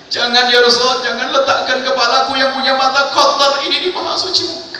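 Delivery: high, loud, tearful begging; anguished plea. Acted. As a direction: beg him with breaking remorse. The plea repeated, raised. (Tha'labah memohon kepada Rasulullah dengan suara tinggi penuh sesal)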